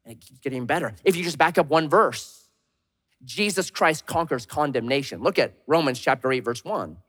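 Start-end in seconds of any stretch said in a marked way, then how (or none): none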